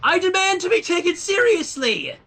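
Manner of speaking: shrill-high, loud furious outburst; angry demand. A narrator's reading. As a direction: demand respect at the top of her voice. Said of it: "stamped her hoof yet more angrily".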